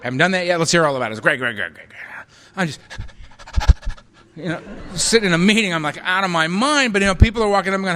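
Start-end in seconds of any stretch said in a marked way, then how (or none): none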